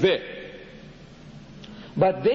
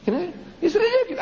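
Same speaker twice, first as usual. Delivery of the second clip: laughing scorn; 14 semitones higher, faster than usual. Real, not acted. Mocking an absurd translation.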